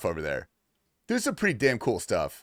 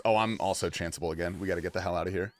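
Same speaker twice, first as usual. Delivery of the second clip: ordinary talk, nothing marked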